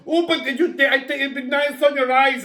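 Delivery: high, insistent mock sales pitch; persistent makeup seller. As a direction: imitate a seller who won't take no. Mimics persistent cosmetics seller.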